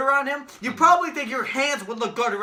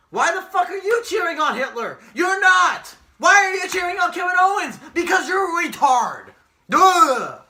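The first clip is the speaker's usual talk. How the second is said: higher and louder throughout, yelling; angry shout; 6 semitones higher, 6 dB louder than usual. Real, not acted. Yelling at fans who cheer the heel, angry shout. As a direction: berate them at full volume.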